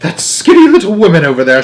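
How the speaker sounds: father shouting across the room; shouted. Acted. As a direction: shout and point. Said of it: "shouted Mister Jenkins".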